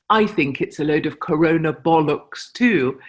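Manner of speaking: a light put-on British accent; mimicking a British accent. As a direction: copy the British speaker's accent, half joking. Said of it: "and mimicked my accent".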